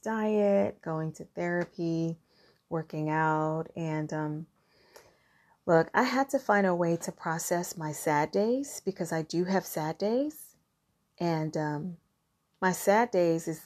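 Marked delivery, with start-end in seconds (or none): none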